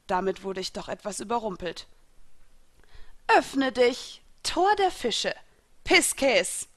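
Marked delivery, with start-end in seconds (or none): 3.3-6.7 high, raised incantation; commanding summons; summoning call. A narrator's reading. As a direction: proclaim the spell in separate bursts. Villain summons her spirits.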